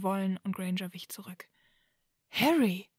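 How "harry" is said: raised, urgent call of a name; loud pleading call. A narrator's reading. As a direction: call out louder, letting the pitch swoop and fall. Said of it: "rief sie wieder".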